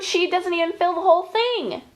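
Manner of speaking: ordinary talk, nothing marked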